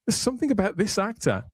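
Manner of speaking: usual speaking voice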